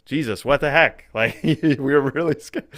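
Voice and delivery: loud, high, laughing mock scold; a mother half-laughing at her lost boy; exasperated mother. Retelling Mary scolding the boy Jesus, loud and laughing. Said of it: "Mary who speaks and says".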